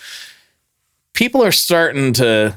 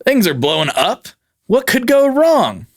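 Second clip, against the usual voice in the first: mock carefree; 8 semitones higher than usual. Father Yod shrugging off hang-gliding risk, mock carefree. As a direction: toss it off like nothing could fail. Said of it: "He's like".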